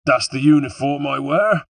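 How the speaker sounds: high, loud West Country sneer; scornful West Country villain voice. A narrator's reading. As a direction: sneer it back at your pursuer. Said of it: "he sneered".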